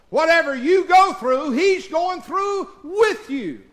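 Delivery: high, slow ringing shout; preaching shout. Real, not acted. Preacher assuring his church in a high shout. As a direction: proclaim it to the rafters.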